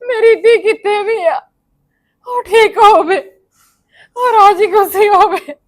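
loud, high sobbing plea; crying prayer. Acted. Mother praying aloud for her missing daughter, sobbing. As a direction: wail it out to God through tears.

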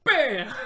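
loud explosive bam; shouted cannon boom. Pretend. Cannon shot sound effect.

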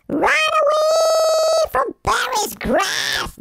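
high, very slow, stretched-out call; dramatic shout. Real, not acted. Gamer urges Pomni to flee, slow high shout. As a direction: cry it out like a movie trailer.

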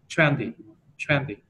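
"trendy" punched on TREN, light falling -dy; stress on first syllable. Shown as a demonstration. Teacher explaining tens to a student, first syllable stressed in "trendy". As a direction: hit the first syllable of "trendy", let the end drop. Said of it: "trọng âm của nó vào cái từ trước".

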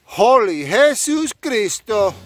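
slow, high, loud exclamation; hammed-up amazement. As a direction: proclaim it with playful astonishment. Mock amazement at the sight.

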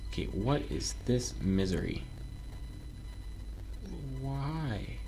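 ordinary talk

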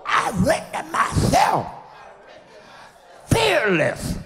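loud, high preacher's shout, phrase by phrase; full-force preaching declaration. Real, not acted. Preacher leading the church in speaking fearlessness, shouted declaration. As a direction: proclaim each phrase for a congregation to echo.

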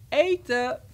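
loud dinner call; roepende moeder. A narrator's reading. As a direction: call the children to the table, loudly. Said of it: "moeder nog eens riep". (luid roepen dat het eten is)

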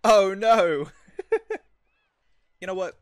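ordinary delivery

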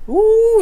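squealing ooh, very high and loud; a thrilled high-pitched ooh; delighted high ooh. Real, not acted. Sees the toasted bread.

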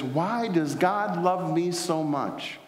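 slow, high, mild imitation of crying wonder; a touch of a weeping girl's question; retold tearful plea. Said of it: "she just began to cry loudly".

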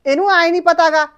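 loud, aggrieved yelling; bitter shout. Acted. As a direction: fling the old hurt out loud. Mother shouts about her upbringing.

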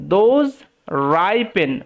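very slow, separated words; word-by-word reading. Shown as a demonstration. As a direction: read out slowly for learners. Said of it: "ভেঙে ভেঙে উচ্চারণ করার চেষ্টা করি". (ভেঙে ভেঙে ধীরে পড়া)

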